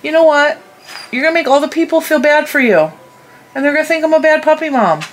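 high sing-song scolding of the puppy; pet talk. Real, not acted. Mom scolding her puppy in a high pet voice.

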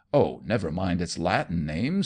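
plain reading voice

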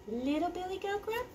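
high, soft little voice; little goat. A narrator's reading. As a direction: introduce the tiny goat high and small.

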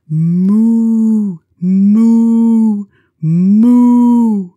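three big loud moos. Pretend. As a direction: bellow it like a cow. Duck mooing loud.

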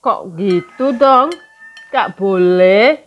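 scolding a picky eater at the table, loud and slow; playful scolding. Real, not acted. Scolding someone at a meal.

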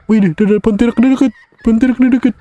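warning that the ghost is close; alarmed high cry. Real, not acted. Gamer warns Adi the Pontianak is near, high and alarmed.

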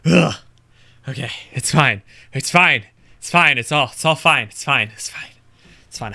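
loud, breathless "it's fine"; panicked self-reassurance. Real, not acted. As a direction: talk yourself down after a scare. Calming self after scare.

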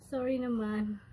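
normal talking voice

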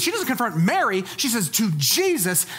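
normal talking voice

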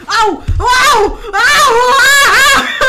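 real high, very loud yelps; loud screaming. Real, not acted. Player startled by the game, loud high screaming. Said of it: "loud screaming".

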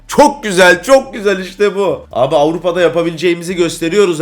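high, loud cry of delight; elated. Real, not acted. Gamer celebrating a European win, elated.

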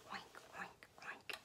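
whisper. Acted. Whispered pig sounds.

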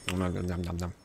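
soft, low nom noises; munching sounds while the character eats; mock munching. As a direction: pretend to chew happily.

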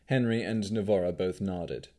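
usual speaking voice

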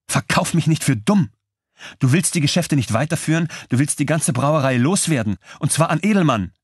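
high, heated and cutting; angry accusation. A narrator's reading. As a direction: hurl the accusation at him. Widow accuses Benzing.

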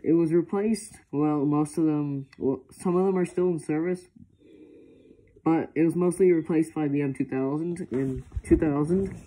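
muffled behind mask filter; through a gas mask. Real, not acted. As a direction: voice inside respirator. Seen 4: Full-face gas mask worn over mouth throughout the labelled speech.

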